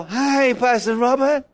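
tame, put-on politeness; mock feeble welcome. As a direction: lightly parody an underwhelmed churchgoer. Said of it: "Like a lump on a rock".

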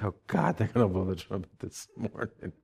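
low, hushed mock dread; quiet, sinking worry; Joshua. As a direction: mutter a worried aside to God. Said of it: "He's like".

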